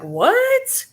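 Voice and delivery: high, sharp disbelieving yelp; shocked what. Retold or imitated. Hearing the man's claim, high shocked what. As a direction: jump up in disbelief. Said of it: "I was like".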